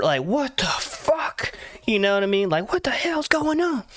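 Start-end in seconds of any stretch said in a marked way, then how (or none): 0.2-1.5 high, drawn-out disbelief; put-on high-pitched startled voice; mock startled wife. Wife woken by his vomiting. 2.7-3.8 high-pitched alarmed question; put-on high voice, baffled; mock startled wife. Wife woken by his vomiting.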